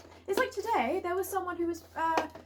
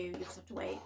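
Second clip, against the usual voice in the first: whispering; 5 semitones lower, 9 dB quieter than usual. Retold or imitated. Retold polite refusal, whispered.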